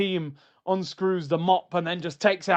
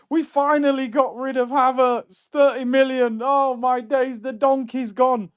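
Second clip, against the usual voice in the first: excited; 5 semitones higher, 5 dB louder than usual. Real, not acted. Celebrating a player's departure.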